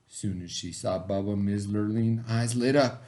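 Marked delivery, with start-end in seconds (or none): none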